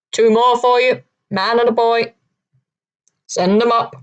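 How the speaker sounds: lightly voiced London accent calling down; a hint of a London sailor's accent. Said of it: "said the head in a London accent".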